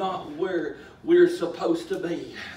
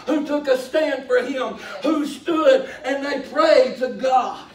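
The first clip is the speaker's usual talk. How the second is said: loud, rousing pulpit delivery; impassioned, raised sermon voice; full-force preaching; 3 semitones higher, 6 dB louder than usual. Real, not acted. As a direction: drive it home to the congregation.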